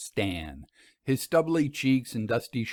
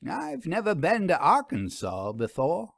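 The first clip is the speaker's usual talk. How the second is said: higher, stretched reluctant admission; grudging concession; 7 semitones higher than usual. A narrator's reading. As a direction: Vera giving in with a sigh-like lilt. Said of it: "She then conceded".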